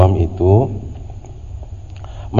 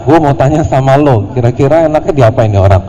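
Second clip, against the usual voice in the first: louder, higher mock of a rude asker; rude demand; 4 semitones higher, 6 dB louder than usual. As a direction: throw the question like a brat. Mocking the rude questioner. (nada kasar dan ketus, sengaja dibuat-buat)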